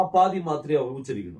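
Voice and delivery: ordinary talk, nothing marked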